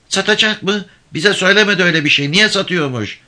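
high-pitched, surprised questioning; a mother protesting at unexpected news; taken aback. A narrator's reading. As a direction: voice jumps up in disbelief. Mother startled by news of sale.

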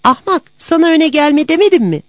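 high, loud scolding; angry cat shouting. A narrator's reading. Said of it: "diye bağırdı".

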